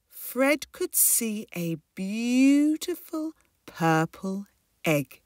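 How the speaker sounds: plain reading voice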